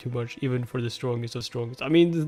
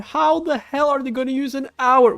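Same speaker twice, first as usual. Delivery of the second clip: indignant rant; 11 semitones higher, 6 dB louder than usual. Real, not acted. Ranting at useless instructors.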